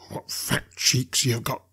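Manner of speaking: slow, relishing menace; slow predatory appraisal; leering convict. A narrator's reading. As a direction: savour it as a hungry convict. Convict eyeing the boy hungrily.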